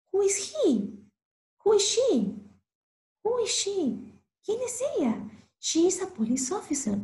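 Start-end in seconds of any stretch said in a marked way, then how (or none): 0.1-5.3 high, gasping who-is-she; surprised gasp. Acted. Teacher acts amazed as the police officer appears in the story.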